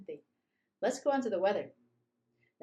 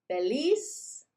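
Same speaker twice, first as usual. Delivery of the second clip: happy cheer; 6 semitones higher, 7 dB louder than usual. Shown as a demonstration. Teacher showing happy emotion.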